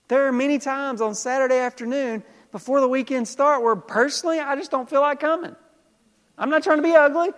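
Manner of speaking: high, loud preacher's fervor; full-force preaching. Acted. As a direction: preach it out big to the room.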